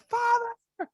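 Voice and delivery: very high, drawn-out mock scream. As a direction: scream it as a drowning child, hammed-up. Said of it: "everyone starts screaming".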